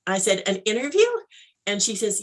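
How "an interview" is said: mildly incredulous question, pitched up; surprised echo. Retold or imitated. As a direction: echo it back as a puzzled question. Echoes the news in disbelief.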